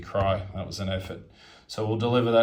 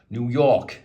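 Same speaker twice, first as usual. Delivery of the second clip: badly faked New York accent, punched louder; 6 dB louder than usual. Player naming New York in his unit recap, bad New York accent. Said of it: "that's a pretty bad accent".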